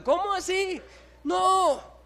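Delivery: high, loud cry of disbelief; sudden shocked no; shocked outcry. Acted. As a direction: gasp it out like you can't believe it.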